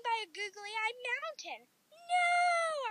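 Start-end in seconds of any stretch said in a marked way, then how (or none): 1.9-2.9 long, high cry of no; drawn-out storybook yell. A narrator's reading. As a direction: wail it out, pitched way up. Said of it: "I yelled".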